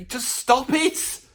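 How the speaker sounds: slow, drawn-out disbelief; slow mock despair at an aging rocker; exasperated plea. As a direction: plead with him to stop, dragging each word. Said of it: "I feel like going".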